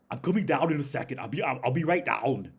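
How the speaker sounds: put-on loud, high Schwarzenegger-style Austrian accent. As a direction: imitate an Austrian dude calling out, Arnold-style. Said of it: "had his exact accent".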